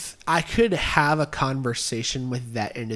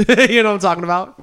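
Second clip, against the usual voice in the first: ordinary talk, nothing marked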